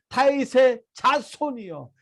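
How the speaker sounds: "다윗의 자손이여" as a slow, emphatic mock cry; emphatic retold cry. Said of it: "강조하고 있어요".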